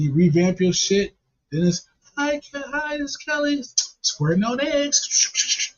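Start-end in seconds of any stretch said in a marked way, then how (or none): 2.2-5.7 put-on very high, drawn-out girly voice; mock-perky high feminine intro; cam-girl intro. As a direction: put on a sugary high camgirl voice, stretching the last words. Acting out second cam-girl intro.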